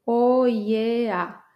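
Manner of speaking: O I E A drawn out slowly; O, I, E, A said one by one; slow vowel spelling. Shown as a demonstration.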